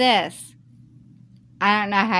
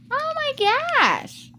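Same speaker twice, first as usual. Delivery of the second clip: gasp of shock. Real, not acted. Shocked reaction to husband's insult.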